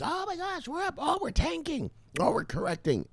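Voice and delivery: mocking traders who freak out over every small move in a high squeal; mocking panicky traders. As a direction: ham up a hysterical crypto nerd. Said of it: "you guys are freaking out".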